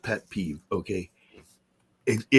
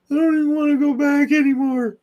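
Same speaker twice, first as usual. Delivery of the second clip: put-on much higher, louder whiny child voice; whiny kid; 6 dB louder than usual. A teased kid whining to his mom, high and louder.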